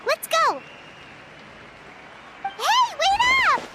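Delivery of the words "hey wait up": calling out. Acted. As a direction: shout ahead so they wait. Running after friends, calling.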